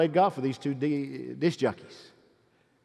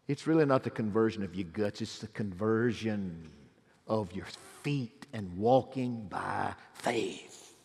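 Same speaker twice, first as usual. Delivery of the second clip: low, slow, softened preaching; solemn altar appeal; 9 semitones lower, 5 dB quieter, slower than usual. Real, not acted. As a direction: lay it down gravely, word by word.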